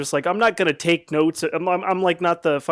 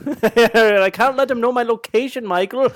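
put-on leprechaun Irish accent; 7 semitones higher, 5 dB louder than usual. Continuing Fiona Irish bit.

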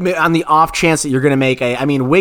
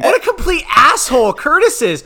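high shouted outrage; mock outraged shout; 12 semitones higher than usual. Real, not acted. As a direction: yell it in amused disbelief. Host outraged-amused at Curtis prank.

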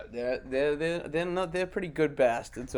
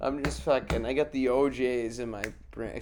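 ordinary talk, nothing marked

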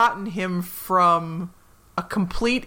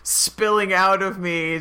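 usual speaking voice

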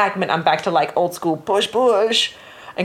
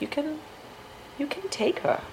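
sheepish offer; 10 semitones higher, 10 dB quieter than usual. Retold or imitated. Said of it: "and we were like".

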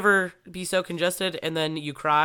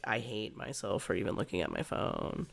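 low, much softer aside to herself; retold self-reproach; 6 semitones lower, 10 dB quieter than usual. Woman kicking herself for looking at her phone, low self-reproach. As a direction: drop it low and quiet, as if to yourself.

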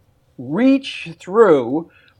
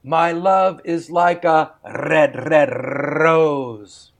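rolled Scottish burr, slow and lilting; a light Scottish accent, read slowly; 2 semitones lower, slower than usual. Shown as a demonstration. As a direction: recite it in a Scottish accent like Robert Burns. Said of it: "add Robert Burns' Scottish burr".

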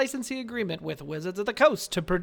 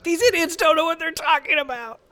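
snooty know-it-all voice, pitched higher; mock nerd; 8 semitones higher, 3 dB louder than usual. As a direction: sneer like a nerd listener. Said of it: "real nerds listening to our podcast who are like".

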